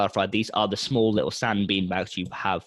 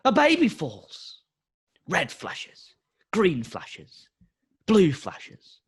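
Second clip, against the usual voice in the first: movie trailer voice. Acted. Dramatic movie trailer narration.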